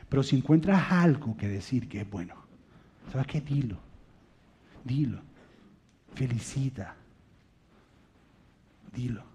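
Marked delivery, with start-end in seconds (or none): none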